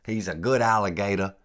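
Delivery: mock Louisiana guide praising a pet; Louisiana accent. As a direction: imitate the Cajun guide cooing at his alligator. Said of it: "he got that Louisiana accent".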